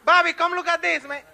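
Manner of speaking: high, loud holler to a friend; calling out. Acted. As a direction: shout him over to come look. Calling a friend over to look.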